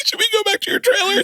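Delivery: very high, tearful joking voice; mock blubbering. Co-star joking as the crying actor after cut, high mock blubber. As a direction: squeal it through pretend sobs. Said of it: "You're like".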